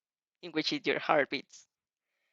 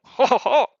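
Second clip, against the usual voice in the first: loud hammed-up ho ho ho, higher; mock laugh; 5 semitones higher, 9 dB louder than usual. Real, not acted. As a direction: belt out a theatrical laugh. Reader laughs at chivalrous offer.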